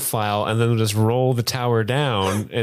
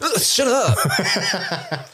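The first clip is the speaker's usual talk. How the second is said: higher, stretched-out laughing groan; laughing shut up; 10 semitones higher, much slower than usual. Real, not acted. Embarrassed by a friend's teasing, laughing groan. Teased about a girl.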